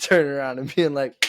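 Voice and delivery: ordinary talk, nothing marked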